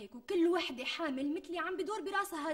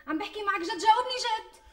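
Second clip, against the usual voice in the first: insistent demand; 8 semitones higher, 5 dB louder than usual. Acted. Wife demands a serious answer.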